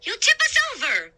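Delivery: high, fast alarmed cry; alarmed warning. A narrator's reading. Woman warning the sailor on the raft, fast alarmed cry. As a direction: scold them before the raft flips.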